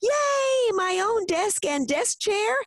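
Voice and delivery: put-on very high-pitched little-girl cheer; excited shout. A narrator's reading. Mindy getting her own desk and chair, excited high shout. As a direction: squeal it high, leaping up with delight. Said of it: "Mindy shouted, leaping to her feet".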